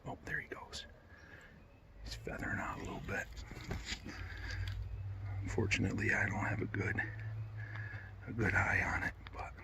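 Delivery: hushed whisper near game; barely voiced, keep it hushed; wildlife watching whisper. Real, not acted. Turkey close, hunter hiding.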